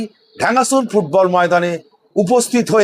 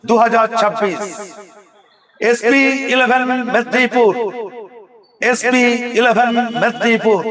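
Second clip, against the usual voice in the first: hype announcer. Acted. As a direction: build hype like a stadium announcer. Hyped team announcement, chanted name. (উত্তেজিত ঘোষকের কণ্ঠে)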